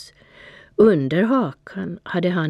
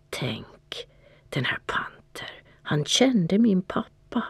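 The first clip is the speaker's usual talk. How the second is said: breathless, voiceless whisper; whispered thought; 3 semitones higher, 5 dB quieter than usual. A narrator's reading. Cat whispering to itself about Panter. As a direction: hush it like a secret you can hardly believe. Cat's awed inner thought.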